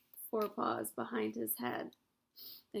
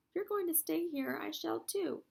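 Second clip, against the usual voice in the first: insistent; 7 semitones higher, 4 dB louder than usual. A narrator's reading. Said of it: "Wilbur insists".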